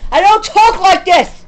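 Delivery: sudden loud, high-pitched outburst; angry shout. Real, not acted. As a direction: shout it in outrage at yourself. Outburst at own way of talking.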